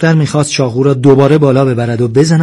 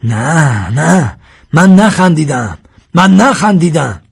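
high, loud, insistent protest; raised protesting yell; shouted denial; 5 semitones higher, 6 dB louder, slower than usual. A narrator's reading. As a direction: shout your denial, hammering the repeat. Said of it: "ظفر شکارچی داد زد".

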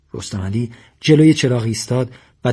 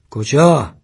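louder challenging question; shout; 3 semitones higher, 5 dB louder, much slower than usual. A narrator's reading. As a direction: call out sharply to stop someone leaving. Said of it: "ساسان داد زد". (پرسش با صدای بلند)

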